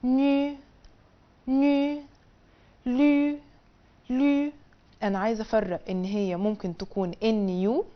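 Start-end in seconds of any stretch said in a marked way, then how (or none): 0.0-4.5 ني and لي said high, loud and clear; teacher models the syllables. Shown as a demonstration. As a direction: sound out ني then لي for the class. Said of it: "بتتنطق".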